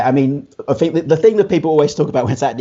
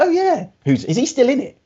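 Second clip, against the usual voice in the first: much higher-pitched retold reaction; retold curious surprise; 13 semitones higher than usual. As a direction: replay your surprised realisation, pitched right up. Retold reaction on remembering the actor.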